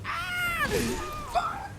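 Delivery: very high screeching cry; pterodactyl screech. Acted. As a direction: screech like a shrieking wyvern. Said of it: "pterodactyl noise".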